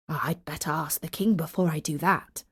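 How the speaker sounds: a hint of a wary old gardener; cautious gardener. A narrator's reading. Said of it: "said the gardener".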